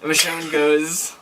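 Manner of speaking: ordinary delivery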